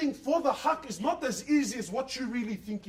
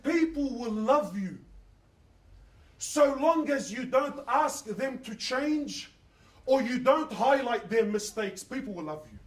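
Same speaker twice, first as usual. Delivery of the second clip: slow, each phrase spaced out; slow, deliberate counsel; slow weighty advice; slower than usual. Retold or imitated. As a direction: pass on hard-won wisdom slowly. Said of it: "Let me tell you something".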